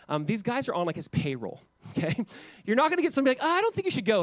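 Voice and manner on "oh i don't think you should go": high-pitched mock objector; hesitant objection. Imagined prophet advising the king not to go, hesitant objection. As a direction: pipe up with a doubtful warning.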